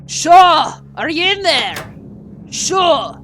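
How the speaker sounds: high, very loud call through a door; urgent shout. Acted. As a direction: holler as if banging on the door. Familiar voice bangs on door.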